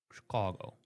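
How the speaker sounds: Chicago low and soft with a broad ah; Jackie's Chicago. Retold or imitated. Host quoting a former colleague's Chicago, low and soft. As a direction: imitate Jackie Walker's Chicago. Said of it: "the way they said it".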